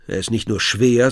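ordinary delivery

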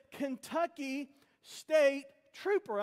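spaced-out, slow reading of a sign. Retold or imitated. Storyteller making out distant lettering on a car, slow reading. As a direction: read it slowly, word by word.

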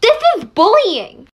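high, loud complaint; upset protest. Acted. Victim objects to classmates' bullying, high upset cry.

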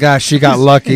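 loud, laughing creep impression; lightly put-on villain's aside; mock van driver. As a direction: play the creep, amused and loud.

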